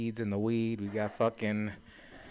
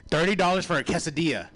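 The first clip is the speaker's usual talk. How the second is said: outraged; 6 semitones higher, 5 dB louder than usual. Real, not acted. Repeats price outraged.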